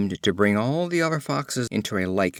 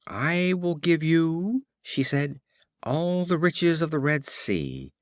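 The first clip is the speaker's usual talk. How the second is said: slow, higher pleading offer; eager tortoise; 6 semitones higher than usual. A narrator's reading. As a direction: coax the eagle with a grand promise. Tortoise bargains with the eagle.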